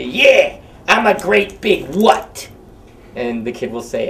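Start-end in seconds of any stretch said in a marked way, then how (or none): 0.0-2.5 high, loud put-on puppet voice, indignant; Humpty puppet scolding a kid, dopey Clinton-esque voice; dopey Humpty Dumpty voice. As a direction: play the dopey egg, offended at being kept waiting. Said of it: "that's his voice".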